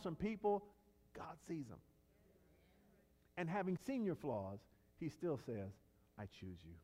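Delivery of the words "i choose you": much lower and much softer, gentle and intimate; God speaking quietly and lovingly to the believer; tender divine voice. Acted. God's tender words to flawed people.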